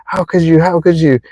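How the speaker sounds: high, accusing rant; acted anger. Wronged person clinging to anger at a partner, acted angry accusation. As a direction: act out someone stuck in indignant blame. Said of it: "this anger is just".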